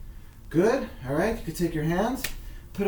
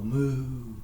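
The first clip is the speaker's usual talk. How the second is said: put-on lightly mooed like a cow for the kids; a playful moo, cow noise for the little ones; imitating a cow; 3 semitones lower than usual. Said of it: "My little guys like to moo".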